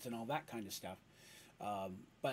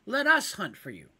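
louder, higher eager offer; higher, louder eager pitch; kender voice; 5 semitones higher, 7 dB louder than usual. Acted. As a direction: sell the deal hard. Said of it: "said Dandy".

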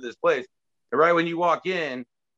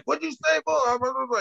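high-pitched imitation of an angry guard; mock yelling; 9 semitones higher than usual. Armed guard confronting a mouthy wrestler, mock yell. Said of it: "he started yelling at him in Spanish".

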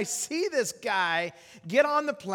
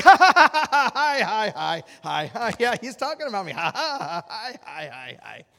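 awkward laughter; 8 dB louder, slower than usual. Retold or imitated. Uncomfortable preacher as nearby passengers stare, awkward laughing. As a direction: laugh helplessly through the embarrassment. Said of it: "I just started to laugh".

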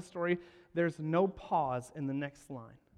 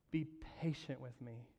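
soft, breathless whispered plea; begging; 4 semitones lower, 12 dB quieter than usual. Acted. Fellow servant begging for mercy on his knees. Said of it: "fell to his knees and begged him".

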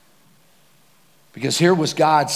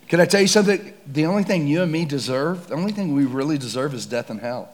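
ordinary delivery

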